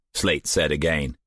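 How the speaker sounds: usual speaking voice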